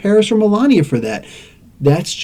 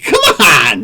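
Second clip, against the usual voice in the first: drawn-out, raised, exasperated cry; exasperated shout. Real, not acted. Ranting at the same look, exasperated shout. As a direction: stretch it out in disbelief. Exasperated rant over identical looks.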